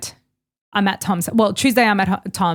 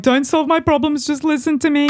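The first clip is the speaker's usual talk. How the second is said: raised pitch, pleading to be heard; half-mock plea, higher; exasperated plea; 6 semitones higher than usual. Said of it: "And I'm like".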